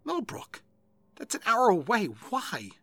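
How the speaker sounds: high, alarmed questioning; shocked disbelief. A narrator's reading. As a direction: react in startled disbelief. Alarmed partner questions order.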